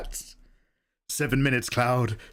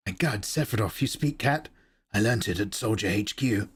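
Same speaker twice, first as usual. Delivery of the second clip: low hero and villain voices; 6 semitones lower than usual. Acted. Dialogue between Cloud and Sephiroth.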